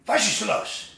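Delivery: Was ist los barked loud and high, like an order; loud commanding Was ist los; harsh German command. Shown as a demonstration. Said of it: "then becomes a command".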